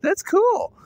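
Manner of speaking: very high, excited squeal; hammed-up wowed reaction; retold amazement. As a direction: gush in disbelief, pitch way up. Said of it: "I couldn't believe it".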